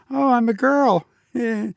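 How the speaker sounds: high hammed-up girly voice; mock girl voice. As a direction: squeak it out like a silly girl. Playful girl voice with wig.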